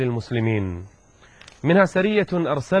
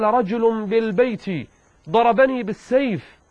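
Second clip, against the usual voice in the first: high, drawn-out narrated alarm; alarmed acted reply; 9 semitones higher than usual. A narrator's reading. Abu Rafi telling his supposed rescuer of the attacker, high alarmed reply. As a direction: raise the pitch as if calling for help. Wounded man's cry for help, narrated.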